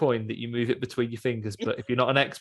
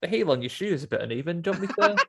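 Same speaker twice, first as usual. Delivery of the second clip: chirpy shopkeeper; 8 semitones higher, much faster than usual. Retold or imitated. Comic mimic of a jobbing shopkeeper.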